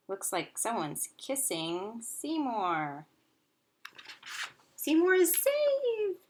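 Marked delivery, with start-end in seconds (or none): 4.8-6.2 high, loud, slow cry of joy; excited exclamation. Acted. Teacher telling kids the hamster is rescued, thrilled. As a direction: announce the rescue like a triumph.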